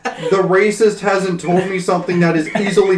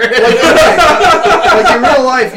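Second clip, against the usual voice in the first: laughing; 8 semitones higher, 9 dB louder than usual. Real, not acted. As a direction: giggle while explaining. Laughing while defending himself.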